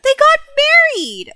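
high, loud shocked disbelief. Real, not acted. Gamer shocked the game lists them as engaged, high disbelief. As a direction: squeal it out, unable to believe it. Game shows wrong relationship status.

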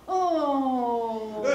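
long, loud, drawn-out moan; spooky "ooooh", held and sliding down; ghostly moan. Acted. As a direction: moan like a pretend night spirit. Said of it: "And I moaned".

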